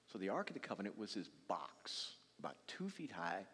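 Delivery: low, hushed explanation; whispered description. Real, not acted. Preacher describing the Ark in a hush.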